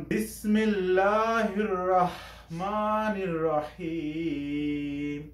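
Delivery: very slow Quranic recitation, ha pressed in the throat. Shown as a demonstration. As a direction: chant it slowly like tilawa. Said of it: "হা আসছে, তখনই আমি চাপ দিয়ে উচ্চারণ করেছি".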